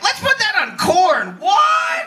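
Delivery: high, loud thrilled pitch; excited idea. Retold or imitated. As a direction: sell the idea with big excitement. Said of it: "Mexicans are like".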